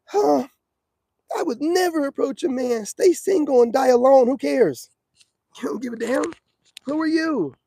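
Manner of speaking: high, mocking voice of a woman online. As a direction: mimic her with a scornful, high-pitched tone. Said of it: "women online with their ego".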